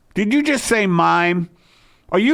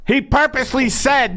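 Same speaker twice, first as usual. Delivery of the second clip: indignant husband; 9 semitones higher, 3 dB louder than usual. Acted. Husband repeats point in argument.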